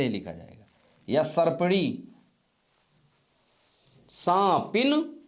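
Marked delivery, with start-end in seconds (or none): 4.1-5.2 सांपिन stretched slowly, ending on a rise; slow dictation. Shown as a demonstration. Teacher to class, dictating सांपिन slowly and clearly. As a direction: sound out सांपिन for the students to write. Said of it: "सर्पिड़ी".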